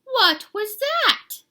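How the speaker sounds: high, loud, drawn-out astonishment; slow, squealed comic disbelief; startled exclamation. A narrator's reading. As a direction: stretch the question out in shock. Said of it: "exclaimed Skippy John Jones".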